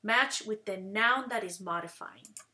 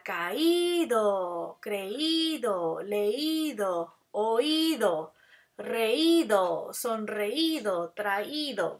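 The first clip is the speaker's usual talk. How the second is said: each í in "caído, creído, leído" held long and stressed; slow list, leaning hard on the accented í of "caído" through "traído"; 3 dB louder, much slower than usual. Shown as a demonstration. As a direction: stretch the í in every word, "oído", "reído", "traído". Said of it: "tiene un acento en la I".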